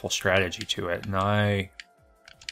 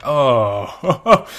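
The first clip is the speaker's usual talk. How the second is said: normal talking voice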